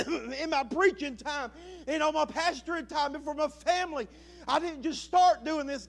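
loud, high, fervent preaching; preaching at full force. Real, not acted. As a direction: pour it all out to the church. Preaching to the congregation.

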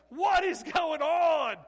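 loud, high, stretched-out yell; bewildered shout. Acted. Houseparent woken by screaming kids, bewildered shout. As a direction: holler it in disbelief, dragging the words.

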